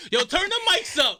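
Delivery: high, loud call to the sound booth; playful shout. Pretend. Joking with the guest about singing, playful shout. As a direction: holler it out, high and urgent.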